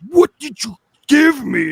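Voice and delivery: high, loud, slow outcry; accusing cry. Retold or imitated. Kid runs out accusing stepbrother, loud high cry. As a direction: yell it at the brother who tricked you.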